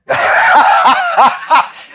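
high bursting laugh; burst of laughter. Real, not acted. Teacher laughing at the class's joke answer, bursting laugh. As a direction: laugh out loud, high and free.

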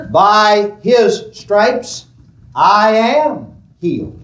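slow, deliberate statement of faith; slow confession. Shown as a demonstration. Preacher modelling the confession slowly for the congregation. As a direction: set the words down slowly for them to follow. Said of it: "I want you to say this with me".

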